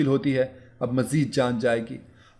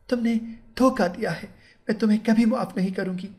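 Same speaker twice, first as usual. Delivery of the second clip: higher, fast accusing bride, understated anger; angry bride; 9 semitones higher, faster than usual. Acted. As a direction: spit out the betrayal, pitched up and quick. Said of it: "वो गुस्से में बोली".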